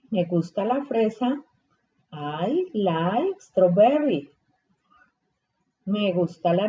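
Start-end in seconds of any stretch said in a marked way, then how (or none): 2.1-4.3 slow, clear classroom model of I like strawberry; slow model sentence. Shown as a demonstration. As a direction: say it slowly for the kids to copy. (despacio y claro, como modelo)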